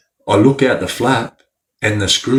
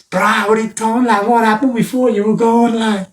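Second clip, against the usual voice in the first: high, far-carrying yard call; 12 semitones higher than usual. Retold or imitated. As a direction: call it across the yards, high. Inmates calling across yards.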